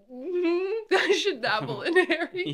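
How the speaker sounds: slow, giggly repeat; laughing. Real, not acted. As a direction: laugh through the words.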